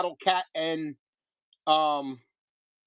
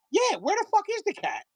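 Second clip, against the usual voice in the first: much higher, mock indignant; mock outrage; 13 semitones higher than usual. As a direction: play up the outrage. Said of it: "and I'm like".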